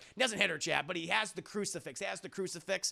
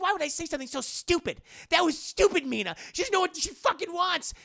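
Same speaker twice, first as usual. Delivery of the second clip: high, loud, exasperated outburst; mock indignation; 11 semitones higher, 6 dB louder than usual. Mina scorning what she said before, hammed-up indignation. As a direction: scoff loudly at your own earlier words. Retold quarrel, contradicting Mina's earlier self.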